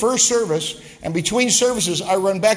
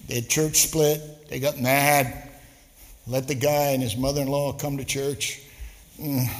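normal talking voice

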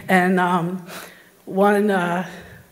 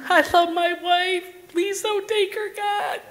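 tearful pleading; 7 semitones higher than usual. Retold or imitated. Husband begs God for his wife, tearful pleading. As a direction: plead through tears.

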